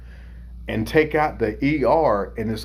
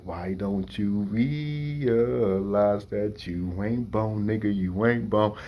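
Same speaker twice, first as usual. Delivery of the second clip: slow, lightly voiced taunt from big brother; mocking older brother; 4 dB quieter, slower than usual. Big brother taunting little brother, slow mild mock rap. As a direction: drag it out like a teasing rap line. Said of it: "he's like".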